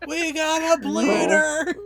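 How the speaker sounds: high, drawn-out mock cry of alarm; hammed-up high-pitched alarm; movie-quote holler. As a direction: call it out like a panicked film line. Quoting comedy film scene.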